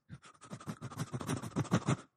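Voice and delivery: soft, breathy little whimper; quiet whimper. Shown as a demonstration. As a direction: whimper softly like a lost boy. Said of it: "cried like this".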